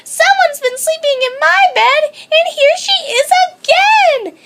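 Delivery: high, loud frightened wail; high cub wailing in fear. A narrator's reading. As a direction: cry out in dread. Baby Bear panicking.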